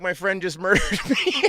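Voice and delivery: high, loud mock disbelief breaking into laughter; hammed-up shock with a laugh; retold outcry. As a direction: cry it out, cracking up. Victim's shock, teller breaking into laughter.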